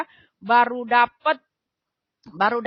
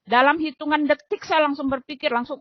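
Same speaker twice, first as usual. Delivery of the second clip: ordinary delivery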